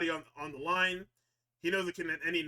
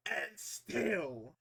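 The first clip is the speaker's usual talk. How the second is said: announcer voice; 3 semitones higher, 6 dB louder, slower than usual. Retold or imitated. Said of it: "with the announcer saying".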